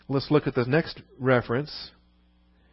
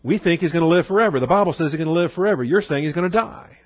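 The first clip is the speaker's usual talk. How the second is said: higher-pitched, insistent imitation of doubters; baffled objection; 4 semitones higher, 5 dB louder than usual. Crowd confronts Jesus over living forever, acted baffled protest. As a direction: argue the contradiction as the confused crowd. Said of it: "To them, it doesn't make any sense".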